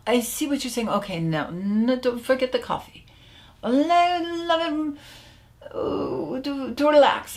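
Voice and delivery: high, halting, lightly faked French accent; French client. Client backtracking to the coach, hesitant mock French accent. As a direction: imitate a Frenchman groping for another reason. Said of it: "he was French".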